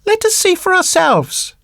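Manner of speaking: high-pitched little puppet voice; puppet boy. Acted. Puppet boy spots a cottage, high little voice. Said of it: "said Pinocchio".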